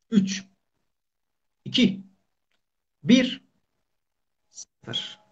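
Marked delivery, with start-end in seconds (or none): 4.5-5.2 whispered zero. Pretend. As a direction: whisper the last count. Countdown ends in whisper.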